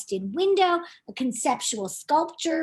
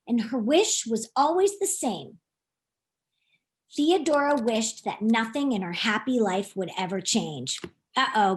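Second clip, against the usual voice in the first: ordinary delivery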